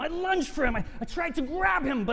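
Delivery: rising to a triumphant attack; hungry, climbing excitement as he leaps; fierce excitement. Acted. Lunging at his prey.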